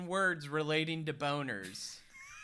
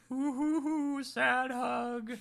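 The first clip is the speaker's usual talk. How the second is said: mock sad hoot; 8 semitones higher, slower than usual. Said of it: "And they're like".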